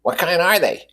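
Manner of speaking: high, eager question; eagerly. A narrator's reading. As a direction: lift it up, keen to know. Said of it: "asked Miss Wilson eagerly".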